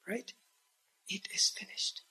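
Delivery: whispered, barely audible repetition; hushed whisper. Real, not acted. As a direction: let the closing words fall to a reverent whisper. Hushed close of the sermon.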